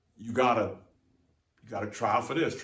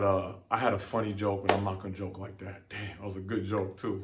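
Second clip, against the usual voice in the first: ordinary talk, nothing marked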